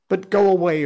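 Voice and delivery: high loud begging; mother begging her son to run; urgent plea. Acted. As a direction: urge him to flee.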